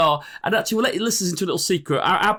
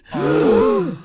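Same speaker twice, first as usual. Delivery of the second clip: excited gasp; 5 dB louder than usual. Real, not acted. Celebrating reviews.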